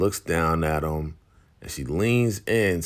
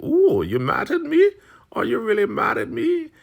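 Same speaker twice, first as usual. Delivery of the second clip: very high, loud mock-offended taunt; hammed-up teasing of a furious rival; goading; 6 dB louder than usual. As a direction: needle them in a squealing sing-song. Goading opponent in acted quarrel.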